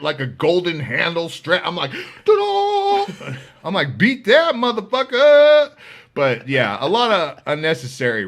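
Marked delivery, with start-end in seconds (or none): 2.3-3.3 very high showman's ta-da; triumphant ta-da. Retold or imitated. Unveiling his huge knife to the rival, high triumphant ta-da. 4.0-5.7 much higher, louder gloating yell; triumphant taunt. Retold or imitated. Daring the rival inmate to top his knife, loud gloating yell.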